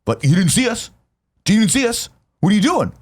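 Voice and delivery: mock the smug couple who scold you; imitating the yuppie couple, high and prissy. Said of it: "some fucking asshole yuppie couple is gonna be like".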